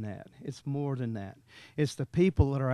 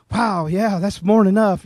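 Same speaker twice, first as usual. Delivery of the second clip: surprised thanks; 3 semitones higher, 10 dB louder than usual. Retold or imitated. Stranger stunned by the gift.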